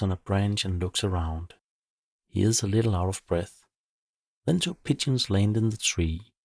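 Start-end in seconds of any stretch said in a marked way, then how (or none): none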